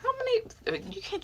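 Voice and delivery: very high squeak of disbelief; squeaky surprise. Real, not acted. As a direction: squeak it out in shock.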